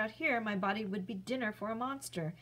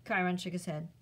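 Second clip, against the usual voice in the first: ordinary delivery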